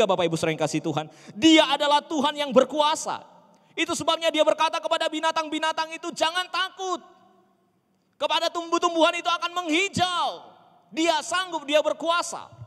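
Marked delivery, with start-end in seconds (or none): 1.4-12.5 high, loud preacher's proclamation; preaching at full force. Real, not acted. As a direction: ring out God's power to the congregation. (khotbah berapi-api, lantang)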